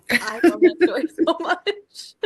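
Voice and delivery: so moved you can barely get it out; laughing through tears. Real, not acted. Host reacting to guest's love story, laughing and teary. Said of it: "can't handle it".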